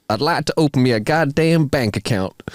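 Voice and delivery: badly faked Texas drawl. Large Texan asking a bank teller, in a put-on Texas drawl. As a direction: put on an amateur Texan twang. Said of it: "says in a noticeable Texas drawl".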